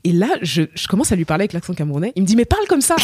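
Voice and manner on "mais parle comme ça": high-pitched, animated exclamation; excited teacher. Retold or imitated. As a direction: imitate a teacher's sudden delighted urging. Teacher's excited reaction, retold. (prof qui s'exclame, voix très aiguë)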